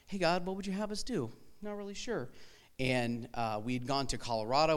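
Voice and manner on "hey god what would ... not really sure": lightly voiced prayer, pitched higher; mock prayer. Asking God what's next, higher, lightly voiced prayer. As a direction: ask God with a hint of uncertainty. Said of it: "journey of trying to figure out".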